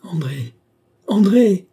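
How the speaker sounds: high call rising to a shout; crying out. A narrator's reading. Brother searching for his sister as he approaches, crying out. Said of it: "cria le jeune homme".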